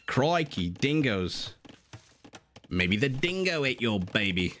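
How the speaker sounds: put-on a shaky, half-laughing stab at an Australian accent; attempted Australian accent. Streamer trying an Australian accent for chat, laughing. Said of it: "my accents are".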